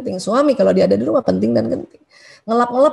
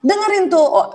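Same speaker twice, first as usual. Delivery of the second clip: ordinary delivery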